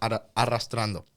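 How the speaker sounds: "arrastrando" corrected with a trilled double R; restart, then roll the R's in "arrastrando"; Spanish trill on the "rr" of "arrastrando". Real, not acted. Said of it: "you could roll your R's pretty good".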